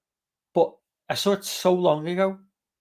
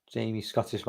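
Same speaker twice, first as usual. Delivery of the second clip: ordinary delivery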